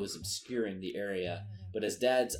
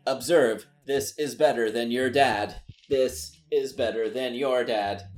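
loud, proud showing-off announcement; boastful robot dad; 2 semitones higher, 7 dB louder than usual. Acted. Robot dad boasting to a street kid, loud and proud. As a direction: boom it out like a showoff machine.

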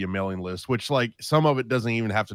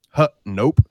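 blunt dismissive nope; 4 semitones higher, 11 dB louder than usual. Pretend. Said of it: "Seattle was like".

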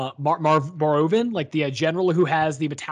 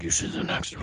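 low husky whisper; 13 semitones lower, 7 dB quieter than usual. Retold or imitated. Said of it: "Or, sorry, huskily".

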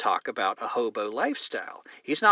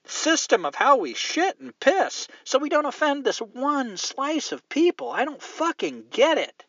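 higher, louder indignant rant; heated rant; 11 semitones higher, 3 dB louder than usual. Real, not acted. Host fumes about being told to change his language. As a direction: vent the outrage, pitch climbing. Ranting about the offended group.

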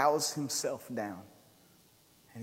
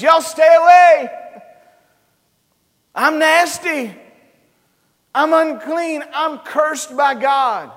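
louder, higher shouted warning; leper's desperate cry; 11 semitones higher, 16 dB louder, slower than usual. Acted. Leper shouting to the crowd from afar, desperate. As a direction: cry out to keep the crowd back. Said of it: "All he could do was cry from a distance".

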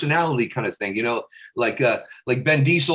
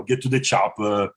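put-on slow, lower Austrian-accented action hero; Arnold Schwarzenegger impression; 4 semitones lower than usual. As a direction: bark the famous line like Schwarzenegger. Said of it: "do, like, an Arnold Schwarzenegger".

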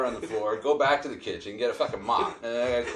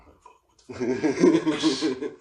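slow stoned drawl; 5 semitones higher, 6 dB louder, much slower than usual. Retold or imitated. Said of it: "in a chair going".

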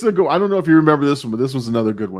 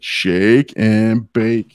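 drawn-out catchphrase, very slow; quoted catchphrase; 5 semitones lower, much slower than usual. Retold or imitated. Host quotes the film's catchphrase, slow and drawn out. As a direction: stretch out every word of it. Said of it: "When he's like".

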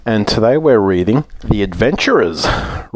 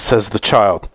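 plain reading voice

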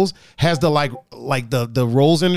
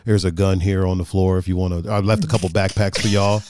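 much lower, deep radio-style voice; mock deep voice; 8 semitones lower than usual. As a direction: imitate Jay's big deep voice. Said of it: "that's what he sounds like".

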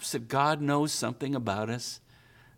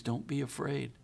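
soft reassurance; 8 dB quieter than usual. Pretend. God's reassurance, much softer.

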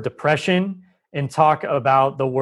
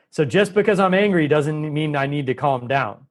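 usual speaking voice

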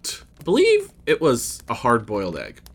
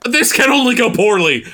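mock panic; 12 semitones higher, 6 dB louder than usual. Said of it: "I say".

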